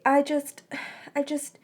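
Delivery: words breaking into sobs; sobbing confession. A narrator's reading. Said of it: "she started sobbing again".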